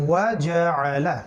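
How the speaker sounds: normal talking voice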